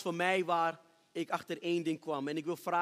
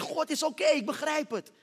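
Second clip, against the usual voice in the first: retold pleading shout; 7 semitones higher, 4 dB louder than usual. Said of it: "ik begon te schreeuwen".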